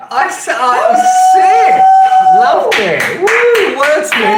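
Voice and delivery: high, loud whoops of delight; wild cheering. Real, not acted. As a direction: scream your approval at the stage. Cheering the AI's announcement.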